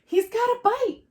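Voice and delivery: put-on high, loud child's cry; excited little girl. A narrator's reading. Little girl sees George's catch, crying out high. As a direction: squeal it like a thrilled kid. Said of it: "the little girl cried".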